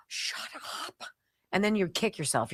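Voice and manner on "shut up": awed whisper of disbelief. Retold or imitated. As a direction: whisper it like you can't believe it. Feeling the soft sheets.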